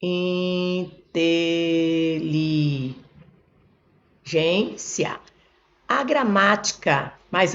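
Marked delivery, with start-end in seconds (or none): 0.0-5.2 "Inteligência" drawn out very slowly, each syllable separate. Shown as a demonstration. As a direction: split "Inteligência" into long, sustained syllables. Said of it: "Vou separar a sílaba aqui".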